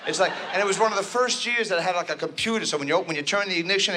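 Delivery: ordinary talk, nothing marked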